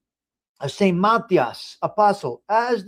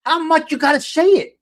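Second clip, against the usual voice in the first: higher and louder, fed up; exasperated outburst; 10 semitones higher, 6 dB louder than usual. Real, not acted. Host fed up with a podcaster's swearing, exasperated outburst. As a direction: throw up your hands at the endless swearing.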